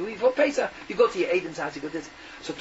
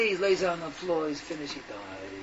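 somber death telling; 4 semitones lower, 5 dB quieter than usual. A narrator's reading. Narrates the parakeet's death.

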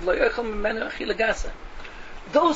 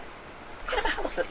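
hushed incredulity; 10 semitones higher, 9 dB quieter than usual. Real, not acted. Repeats incredulous question in hushed tone.

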